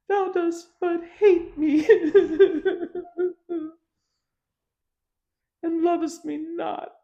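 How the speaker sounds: high, mock-weeping wife's voice; hammed-up crying wife, high and wailing; whining wife. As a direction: whine it like a wife in tears, high up. Voicing Samson's weeping wife.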